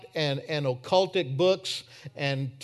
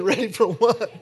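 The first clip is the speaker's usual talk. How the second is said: a louder, laughing ready for what; stunned question; 5 semitones higher, 6 dB louder than usual. Retold or imitated. Preacher retelling his shock at Danny's words, laughing surprise. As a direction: ask it again, amazed and laughing. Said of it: "I did".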